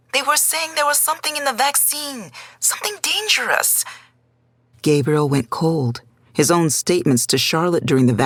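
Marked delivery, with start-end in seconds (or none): 0.1-3.8 put-on higher, softer old grandmother voice; a frightened abuela breaking the news; worried grandmother. A narrator's reading. Grandmother calling Gabriel about Julio, higher worried voice.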